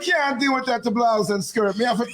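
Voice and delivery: high, playful Jamaican patois voice; lightly put-on Jamaican patois; Jamaican accent. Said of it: "do your Jamaican accent".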